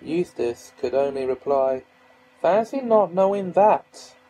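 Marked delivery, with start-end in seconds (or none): 2.4-4.2 scornful. Acted. Eustace scorns Reepicheep's ignorance.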